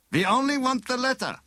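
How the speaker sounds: high, smooth villain voice; bogus inspector. A narrator's reading. Bogus inspector reassures prisoner about the girl, falsely smooth. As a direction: reassure him with oily falseness.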